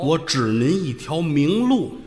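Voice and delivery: very slow, portentous offer; fortune-teller's pitch. Acted. Fortune teller offering guidance to a stranger, slow portentous pitch. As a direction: stretch each word out to hook him.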